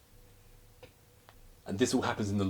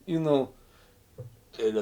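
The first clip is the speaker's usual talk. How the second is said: usual speaking voice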